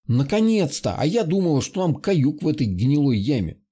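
ordinary delivery

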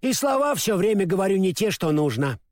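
plain reading voice

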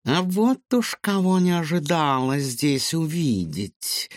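slow, high purring cat voice. A narrator's reading. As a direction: purr it out like a sly cat. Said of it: "промурлыкала кошка".

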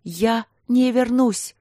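high, slow cry after him; hurled after him as he leaves; shouting. Acted. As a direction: shout it at his back. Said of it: "закричала она".

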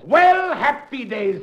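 high, loud and slow happy cheer; mock delight. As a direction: gloat over a big spender. Barker delighted by spender.

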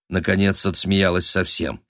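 ordinary delivery

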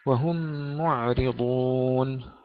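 "وهم معرضون" recited slowly with a still, unbounced ʕayn; tajweed recitation. Shown as a demonstration. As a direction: draw out "وهم معرضون" with the ʕayn held silent. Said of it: "سكون العين".

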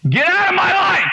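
high shouted rebuke; forceful command. Retold or imitated. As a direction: yell it out to drive something away. Commanding the spirit out, retold.

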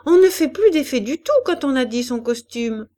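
put-on high, loud childish complaint; child's cry of protest. A narrator's reading. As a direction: exclaim it indignantly as a little girl. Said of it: "s'écria l'enfant".

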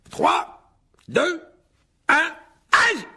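very high, loud countdown ending in a yelp; excited countdown. A narrator's reading. Lockhart counts down the duel for the students, very high. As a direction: count them in with big showy energy.

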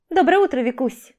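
bright high voice of a chatty friend; ringing cheerful voice. A narrator's reading. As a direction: chirp it out, high and loud. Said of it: "звонкий голос коллеги". (звонкий весёлый голос)

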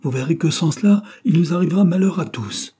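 high, loud furious warning; frantic threat. A narrator's reading. Jealous suitor threatening disaster if refused, frantic threat. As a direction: cry out the threat, beside yourself. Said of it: "s'écria-t-il hors de lui".

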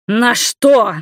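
high, loud startled question; astonished shout. A narrator's reading. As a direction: cry out in disbelief. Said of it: "с удивлением прокричал Игорь".

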